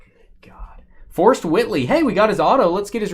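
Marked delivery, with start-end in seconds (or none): 0.0-0.8 whispered under breath; whispering. Real, not acted. Muttered reaction.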